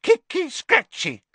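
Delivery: very high gleeful poltergeist cry; happy Peeves. Acted. Peeves goading the fighting elves, very high and happy. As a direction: egg them on with delight. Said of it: "cried Peeves happily".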